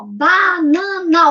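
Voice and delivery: BANANA very slowly, BA, NA, NA; syllable by syllable. Shown as a demonstration. Teacher helps children clap out syllables, syllable by syllable. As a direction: chant BANANA in three long, even beats. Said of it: "você pode bater palma".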